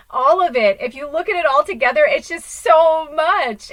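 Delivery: loud, high swell of real excitement; getting excited. Real, not acted. Speaker overwhelmed by how it all fits together, getting excited. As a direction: let excitement lift it, stretching the end. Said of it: "I'm getting excited".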